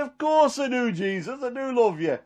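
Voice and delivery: high-pitched earnest insistence; re-enacted pleading reassurance; insistent protest. Peter insists on his love.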